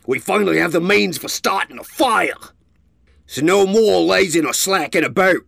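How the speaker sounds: loud, rallying leader voice; commanding call. A narrator's reading. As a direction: call the whole camp to work.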